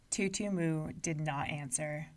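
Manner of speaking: usual speaking voice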